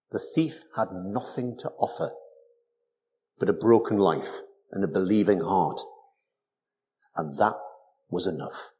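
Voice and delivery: normal talking voice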